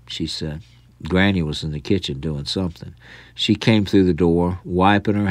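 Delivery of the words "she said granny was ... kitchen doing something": usual speaking voice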